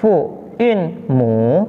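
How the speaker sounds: "fu in mu" slowly, syllable by syllable; "fu in mu" in slow, measured Mandarin syllables; Mandarin term demo. Shown as a demonstration. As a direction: stretch each syllable of "fu in mu". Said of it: "Yang kedua".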